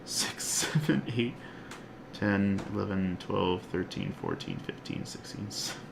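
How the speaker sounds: counting under his breath, fading to a whisper; mumbled tally to himself; soft count trailing into a whisper. Real, not acted. Recounting bones under his breath.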